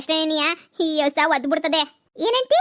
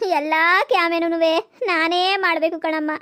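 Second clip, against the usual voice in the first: ordinary delivery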